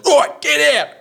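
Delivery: loud, high-pitched mock order; hammed-up shout of command; imitated bouncer shout. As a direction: yell it like staff throwing them out. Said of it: "the staff just goes".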